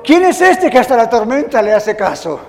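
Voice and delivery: hammed-up frightened amazement; awestruck fear. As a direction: blurt it out, astonished and frightened. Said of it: "muertos de miedo".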